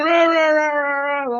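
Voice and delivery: high mock rah-rah whine; mock whining babble. Streamer mocking the troll's babbling complaint.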